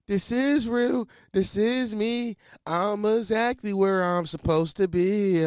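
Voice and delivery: high, slow and drawn out like a movie moment; hammed-up inspirational mantra, stretched out; mock dramatic self-affirmation. Alone with a wet blanket, mocking himself with a grand slow affirmation. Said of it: "And I'm just like".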